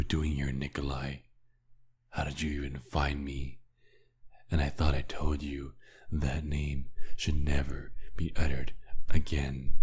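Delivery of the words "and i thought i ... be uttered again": rising to a loud angry shout; louder and louder until he is shouting; furious. Acted. Vampire yelling at his old maker never to say that name, furious shout.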